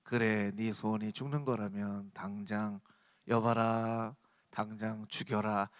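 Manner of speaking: low, slow, soft royal decree; historical drama king. Acted. King answering the courtier's plea, grave low command. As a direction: give the order gravely and unhurriedly. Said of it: "사극에 보면".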